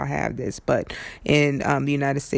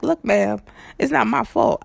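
mild firm retort, higher; 7 semitones higher than usual. Retold or imitated. Rider firmly answering the driver, mildly raised. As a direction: push back on the driver, a little up.

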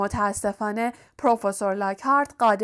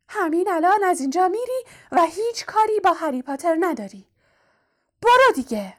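firm, threatening elf; 8 semitones higher than usual. A narrator's reading. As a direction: order him off in a high elf voice. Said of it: "با حالتی تهدیدآمیز تکان داد و با قاطعیت گفت".

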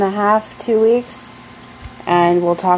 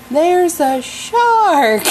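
drawn-out mock movie line; 9 semitones higher, much slower than usual. B-movie quote, drawn out high.

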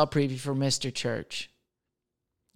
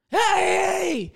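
sudden high yell of surprise; shouted hey. Retold or imitated. Startled neighbor yelling at naked kids at window.

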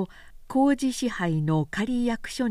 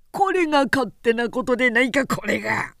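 put-on angry old samurai; 5 semitones higher, 4 dB louder than usual. A narrator's reading. Magistrate flares up.

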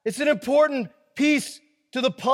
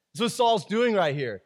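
normal talking voice

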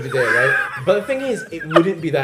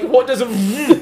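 higher, louder, slow and laughing; laughing confusion; 9 semitones higher, 8 dB louder than usual. Retold or imitated. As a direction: puzzle over the word, laughing. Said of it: "being like".

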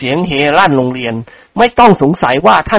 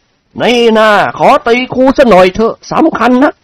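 higher, slow teasing voice; cheeky thug; 7 semitones higher, slower than usual. A narrator's reading. Thug student slapping the teacher, slow teasing. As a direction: drawl out a taunt at the teacher.

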